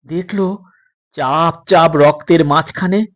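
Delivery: ordinary delivery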